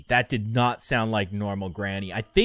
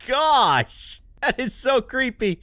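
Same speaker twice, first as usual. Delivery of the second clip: loud, high, stretched-out shock; real scared excitement at the monster; freaked-out excitement; 9 semitones higher, 5 dB louder than usual. Real, not acted. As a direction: react big to a creepy face. Granny's creepy smile up close.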